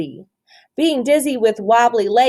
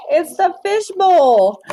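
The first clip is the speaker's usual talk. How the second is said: high, slow playful reveal; amused reveal; 9 semitones higher, 5 dB louder, slower than usual. Real, not acted. To the child, a playful high reveal. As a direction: let the joke land slowly.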